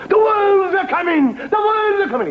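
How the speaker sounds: high, loud, slow panicked shout; alarm cry. Acted. Villagers crying the wolves are coming, high loud shout. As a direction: wail the warning up high.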